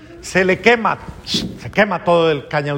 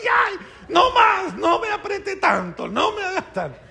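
high, shrill-pitched cries of pain from the squeezed cane; screaming sugarcane; 13 semitones higher than usual. Acted. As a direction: wail like cane crushed in the mill. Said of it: "pero gritando". (la caña en el trapiche gritando de dolor)

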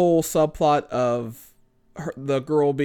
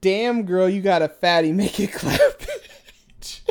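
sassy catcall; 4 semitones higher, 4 dB louder than usual. Shown as a demonstration. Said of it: "It says".

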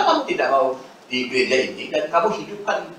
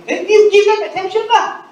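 much higher, louder "give them attention lah"; exasperated retort; 7 dB louder than usual. Acted. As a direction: snap back at the complaint, high and loud. Pastor retorts to a complaining spouse.

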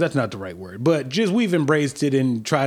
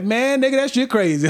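casual street talk; 11 semitones higher than usual. Retold or imitated.

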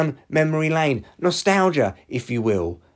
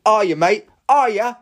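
higher and louder, rising goading jab; heated taunt; 9 semitones higher, 5 dB louder than usual. Real, not acted. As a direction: push it up, really wound up. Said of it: "got a little bit heated there".

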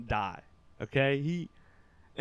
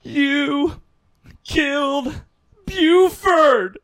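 very loud, high shriek, word by word; imitated scream; 12 semitones higher, 13 dB louder, much slower than usual. Mom accusing her son over the family dog, screaming. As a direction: mimic a hysterical accusation, pausing after each word. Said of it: "That's what she just screams".

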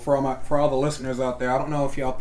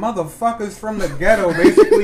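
laughing; 9 semitones higher, 8 dB louder than usual. Real, not acted. Laughing while describing show.

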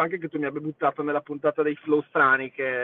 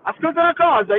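excited; 12 semitones higher, 6 dB louder, faster than usual. Real, not acted. Enthusiastic voice message.

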